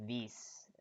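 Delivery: put-on "this" said as "dis" in a Russian accent. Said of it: "in Russian accent, people say".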